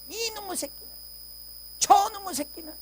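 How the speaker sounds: high mock yell of an angry father; father's yelling. Preacher imitating his father yelling at him as a boy. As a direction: bark at the child like the furious father. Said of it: "소리를 지르기 시작하는 거야".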